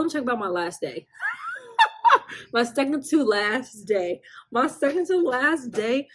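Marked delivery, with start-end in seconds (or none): none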